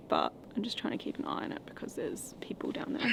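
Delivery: hushed, wary whisper. Real, not acted. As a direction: whisper so the people below don't hear. Strangers nearby in the dark.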